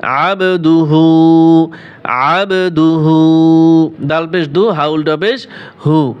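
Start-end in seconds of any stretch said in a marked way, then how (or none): 0.0-3.9 "আবদুহু" with the ব bounced, an echoing b; "আবদুহু" তে ব-এ কলকলা; qalqala echo. Shown as a demonstration. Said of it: "কলকলা হবে".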